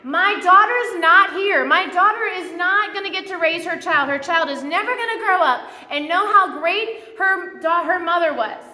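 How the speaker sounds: hammed-up anguished shouting; losing it. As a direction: act out a mother exploding at a funeral. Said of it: "Sally Field loses it".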